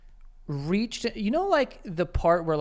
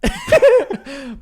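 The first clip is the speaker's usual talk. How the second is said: sudden loud, high laugh; loud burst of laughter; 12 dB louder than usual. Real, not acted. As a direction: crack up out loud.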